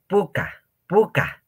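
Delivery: puca said high and slow, with a falling end; teacher pronunciation model; clear model word. Shown as a demonstration. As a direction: model puca for the class to repeat. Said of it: "teacher repeating the word".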